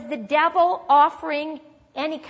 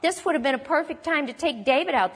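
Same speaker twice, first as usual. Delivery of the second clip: ordinary delivery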